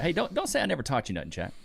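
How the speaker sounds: ordinary talk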